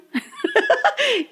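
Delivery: a sudden, high-pitched laugh; high burst of laughter. Real, not acted. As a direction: let the laugh break out high. Laughing at the story.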